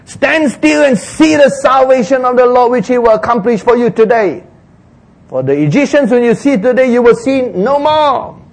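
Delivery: assured leader declaiming high; confident Moses. Acted. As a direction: proclaim it to the whole people.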